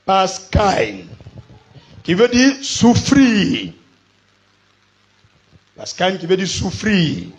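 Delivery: loud, slow proclamation; preacher hammering home a definition; emphatic pulpit teaching. Real, not acted. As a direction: stretch the key word out for the congregation. Proclaims the word's meaning.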